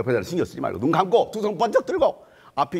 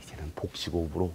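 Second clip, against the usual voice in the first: much softer, half-whispered musing; muttering to himself; 7 semitones lower, 8 dB quieter than usual. Acted. Bartimaeus thinking he was too quiet, murmuring softly. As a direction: mutter it under your breath. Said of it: "하면서".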